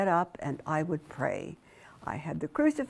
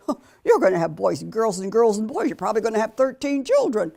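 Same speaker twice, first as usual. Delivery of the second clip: scoffing; 2 semitones higher, 7 dB louder, faster than usual. Retold or imitated. Fiancé mocking her hopes.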